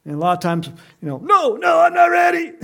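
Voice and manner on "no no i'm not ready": high, loud mock-panicked protest; panicked refusal. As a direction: shout it like you're fending someone off. Acts out people refusing Christ.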